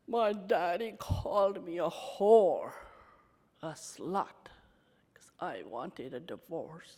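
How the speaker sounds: slow, sinking into a whisper; tearful confession. Retold or imitated. Telling the anchor through tears, fading. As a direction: voice fading to a broken hush.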